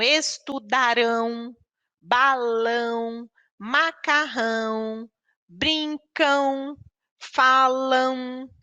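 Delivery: slow, each word drawn out on its stressed syllable; stressed syllable dictation. Shown as a demonstration. Teacher to class, dictating words, stressing the strong syllable. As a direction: spell out "balão" and "falam" syllable by syllable. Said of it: "identificamos qual é o som mais forte".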